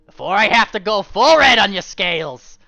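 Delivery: high, loud snarled threat; husband threatening his wife; angry threat. Acted. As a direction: threaten through anger.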